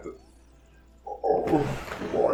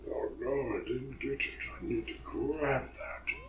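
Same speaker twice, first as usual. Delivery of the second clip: soft unvoiced whisper to himself; 2 semitones higher, 13 dB quieter than usual. Real, not acted. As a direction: whisper it under your breath. Muttering while playing.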